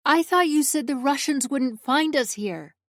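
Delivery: high, loud call down the hall; calling after. A narrator's reading. Narrator calling after Alessio, nervous and loud. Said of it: "I call after him".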